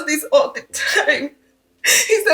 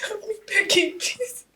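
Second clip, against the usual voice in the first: softer, tearful begging; sobbing plea to a friend; crying plea; 4 semitones higher, 7 dB quieter than usual. Acted. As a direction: beg through sobs. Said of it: "Stop crying".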